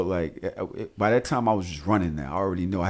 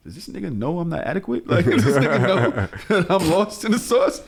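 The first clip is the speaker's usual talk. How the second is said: higher, amused and laughing; laughing disbelief; 6 semitones higher than usual. Retold or imitated. Dad amazed his son is helping, laughing. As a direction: marvel with a laugh. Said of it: "I'm like".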